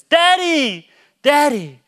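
high, loud mimicked call for a parent; drawn-out falling yell, imitating a son; imitated child calling. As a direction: call out like an insistent little kid. Imitates his son calling urgently.

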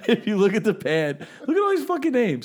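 laughing, voice jumping up. Real, not acted. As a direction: crack up while pointing it out. Laughing while pointing at notes.

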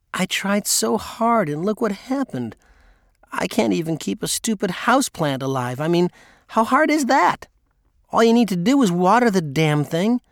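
high-pitched, exasperated self-reproach; upset wife. A narrator's reading. Wife lamenting the dead houseplant to husband, upset and high. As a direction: pitch it up, fretting and frustrated with yourself.

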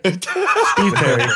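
slow laughing callout. Acted. Psych-out catchphrase, laughing.

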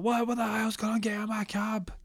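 high, strained cab driver voice; a cabbie losing it; outraged cabbie. Retold or imitated. Cab driver shouts at the men who smashed his window. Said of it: "Driver's like".

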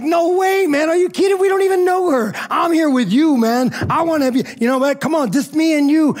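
high, insistent inner outburst; inner protest. Retold or imitated. Husband silently protesting sharing their anniversary dinner, high insistent protest. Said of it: "In my mind, I'm like".

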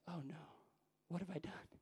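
softly voiced sinking realization; hushed dismay. Young preacher realizing his sermon has failed, hushed dismay.

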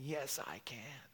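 slow, hushed whisper; whispered recollection. Retold or imitated. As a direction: whisper it like a voice remembered. Retelling remembered voice in her head.

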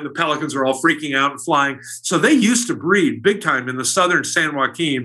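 normal talking voice

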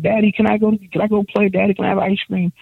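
put-on high childish daddy-asking voice; a father imitates his little girl asking to play. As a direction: chirp it like a small daughter begging. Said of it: "she's like".

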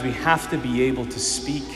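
normal talking voice

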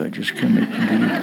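fast, sly mock prayer with a laugh; mock pious prayer. Preacher mocking how people pray for enemies, sly laugh. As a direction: pretend piety, hinting at revenge.

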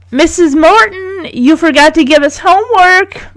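high, singled-out schoolgirl reminder; eager pupil. A narrator's reading. Margot waves at Missus Morton about homework, eager. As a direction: remind the teacher, pitch held high. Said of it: "Margot says, waving her hand".

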